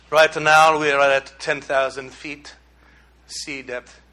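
low, half-hearted Italian captain; attempted Italian accent. Preacher imitating the ship's captain, attempted Italian accent. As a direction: have a rough go at an Italian accent. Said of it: "can't even do an Italian voice".

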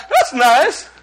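high giggly gush; mock delighted laugh. Preacher mimicking a casual sky-gazer, mock laughing. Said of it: "so you can go".

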